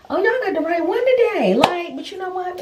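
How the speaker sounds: you picked the wrong one; sassy. Retold or imitated. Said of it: "'cause I was just like".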